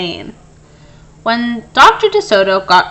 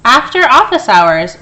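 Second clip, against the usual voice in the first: plain reading voice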